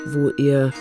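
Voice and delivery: ordinary talk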